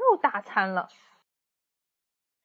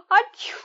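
high, sharp sneeze; comic wolf sneeze; loud sneeze; 13 semitones higher, 6 dB louder than usual. Acted. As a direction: sneeze with a cold.

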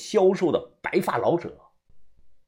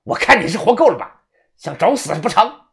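raised, loud, furious threat; angry; 5 semitones higher, 6 dB louder than usual. Acted. Manager freed from the grip, angrily threatening his attacker. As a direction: challenge him in anger. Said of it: "怒声的看着他".

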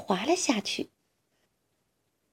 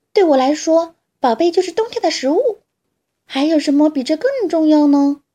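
higher, louder, fast and worked up; squirrel insisting; 6 semitones higher, 6 dB louder than usual. Acted. Squirrel defending acorns to fox, high fast insistent. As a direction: rattle it off, defending your winter food.

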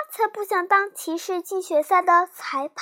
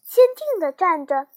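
plain reading voice

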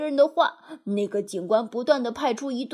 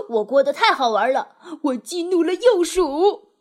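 gleeful, louder, with a laugh; very pleased with himself; 4 semitones higher, 6 dB louder than usual. A narrator's reading. Mole bursts in boasting of his prank, gleeful. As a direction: crow about it, laughing and loud. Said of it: "显然是非常自得其乐的".

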